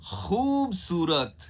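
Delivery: खूबसूरत slow, ख rasped in the throat; slow nukta kh. Shown as a demonstration. Teacher slowly demonstrating खूबसूरत. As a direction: draw out खूबसूरत with the Urdu x. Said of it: "तीसरा शब्द है".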